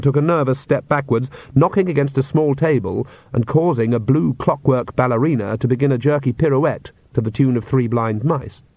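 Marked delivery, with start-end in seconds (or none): none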